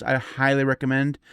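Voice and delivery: ordinary talk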